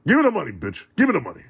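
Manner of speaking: hyped-up call for the money; eager demand. Real, not acted. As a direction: shout it like payday can't come fast enough. Hungry for paychecks, demanding.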